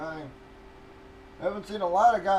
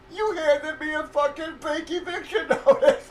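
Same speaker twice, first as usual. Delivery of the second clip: higher and louder, laughing through it; laughing retort; 10 semitones higher, 7 dB louder than usual. Retold or imitated. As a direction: fire it back, breaking into a laugh. Retold comeback to landlord.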